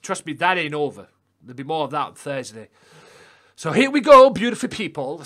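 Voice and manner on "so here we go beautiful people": hype announcer. Real, not acted. Hyping the match to viewers.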